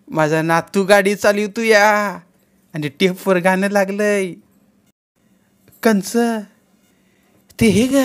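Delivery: grandmother lost in her proud dream; dreamy old grandmother. A narrator's reading.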